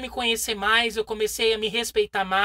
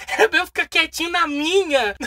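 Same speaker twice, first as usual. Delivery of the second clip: laughing complaint to God; 3 semitones higher, 4 dB louder than usual. Retold or imitated. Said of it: "Aí eu fiquei, Ai".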